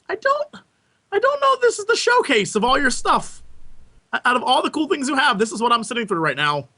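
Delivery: whiny high voice, baffled by the show; high-pitched exasperated voice. Retold or imitated. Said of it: "I was like".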